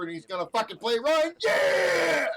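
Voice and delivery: loud, high cheer; hyped. Real, not acted. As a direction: fire up the chat with a whoop. Hyped for favorite player.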